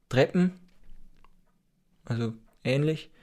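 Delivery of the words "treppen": "Treppen" close to standard, ending raised; Upper Bavarian form. Shown as a demonstration. Teacher giving the Upper Bavarian word, "Treppen" with short e. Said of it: "in Oberbayern einfach".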